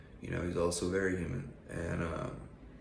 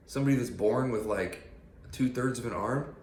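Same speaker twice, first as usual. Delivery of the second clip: ordinary talk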